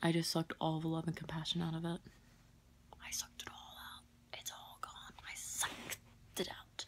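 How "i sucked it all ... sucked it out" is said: hushed, creepy whisper; spooky whisper. Pretend. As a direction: whisper it like a ghost story. Eerie tale of drained stone.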